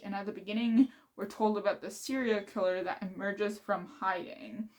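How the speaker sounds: normal talking voice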